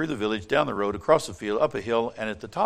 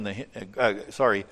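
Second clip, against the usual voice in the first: ordinary delivery, nothing marked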